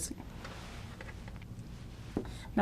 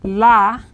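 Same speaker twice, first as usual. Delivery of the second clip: "la" punched out loud on its own; stressed syllable; 8 dB louder than usual. Shown as a demonstration. Teacher isolates the stressed syllable of lápices, loud emphatic "la". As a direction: hit "la" hard and hold it. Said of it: "Again, stress here".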